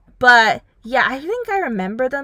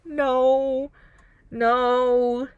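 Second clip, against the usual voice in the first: pleading no; 3 semitones higher, much slower than usual. Retold or imitated. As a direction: repeat no, winding down. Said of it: "It's like".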